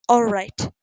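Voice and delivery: slightly husky, reluctant agreement. A narrator's reading. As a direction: give in with a touch of huskiness. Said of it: "Poppy agreed huskily".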